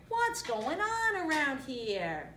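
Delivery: high, slow, drawn-out cartoon cry; meerkat crying out. A narrator's reading. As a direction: cry out in baffled protest. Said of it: "Timon cried".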